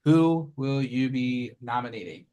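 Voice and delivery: ordinary talk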